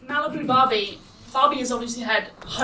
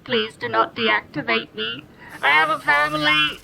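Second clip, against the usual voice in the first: pleading robot. Acted. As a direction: beg like a scared machine. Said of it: "he goes".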